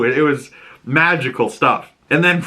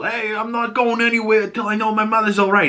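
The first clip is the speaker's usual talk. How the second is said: much higher, put-on pleading voice; high, nervous bluff; Oz bargaining; 11 semitones higher than usual. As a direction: squeak out a demand you can't back up. Oz bargains with Sal.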